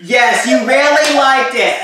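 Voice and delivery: loud, high mock echo; teasing echo. Echoes partner's loud delivery, teasing.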